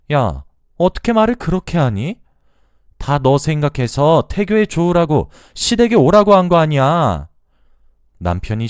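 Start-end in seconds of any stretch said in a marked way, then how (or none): 0.1-7.3 loud, high-pitched mother-in-law telling off; angry scolding. Acted. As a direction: scold the daughter-in-law loudly, voice raised high. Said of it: "시어머니의 잔소리를". (화난 시어머니의 높고 큰 잔소리)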